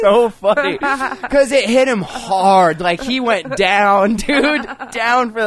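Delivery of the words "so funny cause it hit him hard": laughing. Real, not acted. Laughing while retelling the story.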